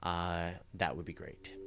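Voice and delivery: normal talking voice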